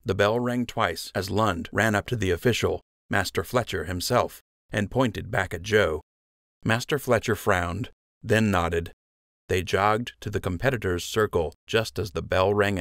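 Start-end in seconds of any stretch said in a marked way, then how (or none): none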